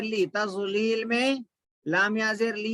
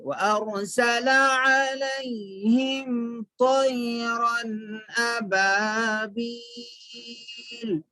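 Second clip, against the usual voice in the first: slow, measured tajweed chanting; Quran recitation; 2 semitones higher, much slower than usual. Shown as a demonstration. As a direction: draw out the long vowels, fading on the last. Recites verse for learners.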